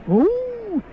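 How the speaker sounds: high, long clownish wail; soft, high "uuuh" of pretend weeping; mock crying howl. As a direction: imitate a child's cry as one drawn-out hoot.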